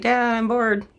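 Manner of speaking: high-pitched mock whine to a parent; bored child's complaint. Bored kid pesters dad, high complaining.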